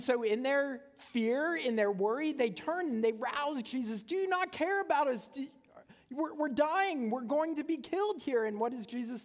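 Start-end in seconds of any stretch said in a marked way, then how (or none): 4.0-8.5 much higher, frantic cry for help; panicked plea. Retold or imitated. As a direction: plead like drowning men shaking him awake. Disciples wake Jesus in storm.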